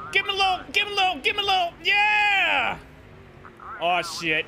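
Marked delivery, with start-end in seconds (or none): 0.1-1.7 excited urging. Real, not acted. Cheering on racing move. 1.8-2.8 triumphant shout. Real, not acted. Winning celebration shout.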